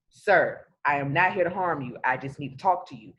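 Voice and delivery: calm. Shown as a demonstration. Showing how a cop should talk to a scared driver, calm and low. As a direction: settle a scared driver down gently. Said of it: "Just do it calmly".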